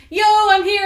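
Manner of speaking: high, loud, drawn-out holler; Macduff calling out. Acted. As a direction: announce yourself at the door, shouting. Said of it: "He's like".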